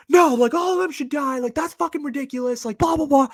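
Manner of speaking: high, loud mocking rant of an angry guy; imitating a ranting yell. Mocking the ranting guy at the dorm coffee shop, high shouted rant. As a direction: mimic a crazed guy yelling about killing people. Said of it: "And then he was like".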